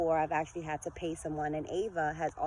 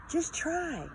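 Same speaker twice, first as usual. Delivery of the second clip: pleading repeat; 9 semitones higher than usual. Shown as a demonstration. Encouraging viewers who doubt themselves, high pleading repeat. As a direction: lift "Just try" high as a plea.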